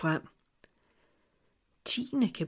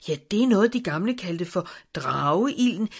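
eager and rushing, building louder; 3 semitones higher, 7 dB louder, faster than usual. Acted. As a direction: blurt it out keenly. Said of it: "svarede ivrigt".

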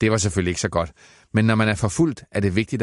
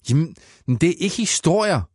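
put-on boy shouts at mother, much higher; shouting boy; 10 semitones higher than usual. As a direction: cry out in protest, sitting up. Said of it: "råbte Max".

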